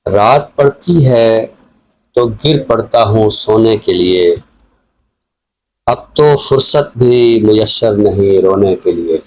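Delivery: slow, measured ghazal recital with lines repeated; unhurried Urdu poetry reading for listeners; poem recitation. Acted. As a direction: recite each couplet slowly, savouring the words.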